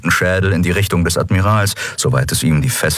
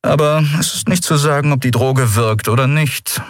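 offhand, higher-pitched official; casual; 8 semitones higher than usual. Acted. Vice commissar on whether the drug works, casual. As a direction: mention it as a minor detail. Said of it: "wie beiläufig".

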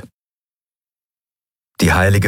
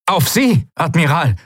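high-pitched urgent reply; calling out; 8 semitones higher than usual. A narrator's reading. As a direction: call back to the admiral, voice pitched up. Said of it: "rief Quailor". (hoch gerufene Antwort)

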